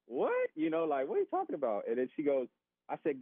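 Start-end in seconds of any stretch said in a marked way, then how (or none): 0.0-1.9 high-pitched incredulous "what?"; retold astonishment, pitched way up; blown away. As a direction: imitate a baffled wife's disbelief. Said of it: "she's just totally blown away".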